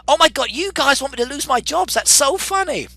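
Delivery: high, loud exclamation; incredulous, tickled outburst to the audience; amused disbelief. Real, not acted. Amused disbelief at poll result.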